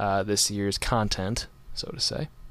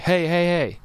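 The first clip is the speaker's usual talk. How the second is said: higher-pitched imitated call for attention; 7 semitones higher than usual. Retelling a festival worker stopping the two of them, calling out. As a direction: mimic someone flagging people down.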